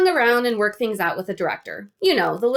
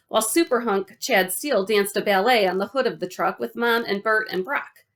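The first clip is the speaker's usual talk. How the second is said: ordinary delivery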